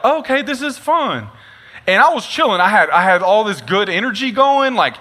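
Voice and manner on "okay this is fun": chill content. Retold or imitated.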